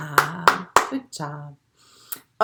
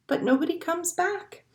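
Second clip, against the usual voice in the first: ordinary delivery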